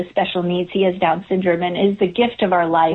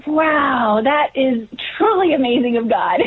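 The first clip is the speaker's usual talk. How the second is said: higher, awed wow easing down; retold amazement; 4 semitones higher, slower than usual. Mother recalling a schedule that somehow changed, retold amazement. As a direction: relive your amazement at God stepping in.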